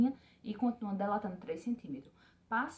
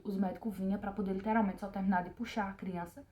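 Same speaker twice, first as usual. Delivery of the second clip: ordinary talk, nothing marked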